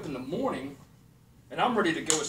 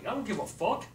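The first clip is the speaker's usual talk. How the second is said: ordinary delivery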